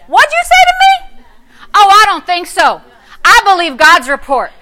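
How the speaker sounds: high, loud, sassy put-down. Pretend. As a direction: shout down the devil with attitude. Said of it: "you gotta get sassy".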